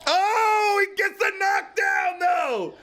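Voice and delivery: high excited yell at a knockdown; excited shout. Real, not acted. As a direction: shout it out, thrilled. Fight watcher reacting to knockdown.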